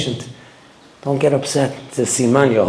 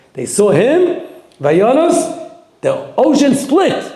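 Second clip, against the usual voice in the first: excited build; 9 semitones higher, 4 dB louder, much slower than usual. Acted. Climax of the sea miracle.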